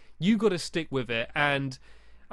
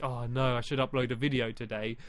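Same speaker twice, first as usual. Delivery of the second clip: mock grumpy sigh; 5 semitones lower, 3 dB quieter than usual. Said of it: "you're like".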